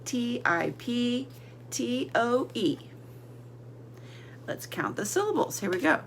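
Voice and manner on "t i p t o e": T I P T O E spelled slowly, letter by letter; spelling slowly. Shown as a demonstration. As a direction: spell it out for the class. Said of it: "Okay, let's spell it".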